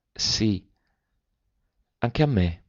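pensive and soft. A narrator's reading. Cousin answers her about the moon, quietly pensive. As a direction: say it softly, lost in thought. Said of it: "e divenne pensieroso".

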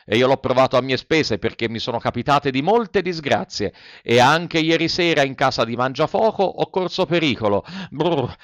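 usual speaking voice

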